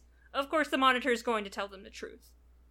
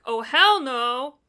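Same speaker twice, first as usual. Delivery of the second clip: loud, drawn-out comic refusal; mock refusal; 2 semitones higher, 7 dB louder, slower than usual. Guilty Spark retold as objecting to the ring's destruction, mock refusal. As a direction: refuse it slowly and loudly, playing it up. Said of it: "then Guilty Spark's like".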